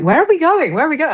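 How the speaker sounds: high, puzzled repeated question; mock bewildered. Actor recalling a scene drifting, high bewildered. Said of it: "you're like".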